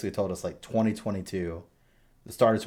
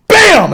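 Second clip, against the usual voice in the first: a big, loud, much higher "Bam!"; 21 dB louder than usual. Retold or imitated. Dad acting out the baby's punch, yelling bam. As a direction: slam the sound out like a punch landing. Baby slams his face.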